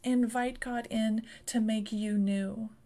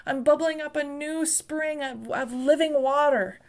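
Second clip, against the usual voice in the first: high, loud rising preacher cry; full-force revival preaching; fervent preaching; 4 semitones higher, 5 dB louder than usual. Acted. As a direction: pour it out to the church.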